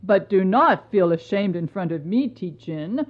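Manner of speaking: lightly voiced tender husband; reassuring emperor. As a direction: comfort her and ease her shame.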